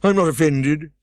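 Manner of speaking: Humpty Dumpty scolding. A narrator's reading. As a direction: snap at the girl from the wall.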